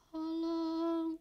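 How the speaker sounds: faint cry from afar. Acted. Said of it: "Lalu hilang lagi".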